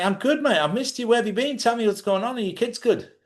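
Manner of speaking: bright mock reunion, high-pitched; cheerful welcome. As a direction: greet an old friend gladly. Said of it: "I would answer, say".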